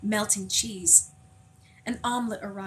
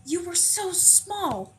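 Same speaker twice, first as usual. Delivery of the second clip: put-on old woman's amazement; 8 semitones higher, slower than usual. A narrator's reading. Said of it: "says a voice".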